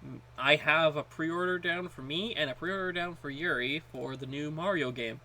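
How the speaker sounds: ordinary delivery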